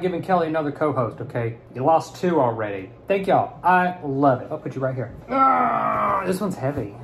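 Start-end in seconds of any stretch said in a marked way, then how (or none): 5.3-6.2 effort grunt. Real, not acted. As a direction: strain as you heave it up. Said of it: "I don't just grunt like that".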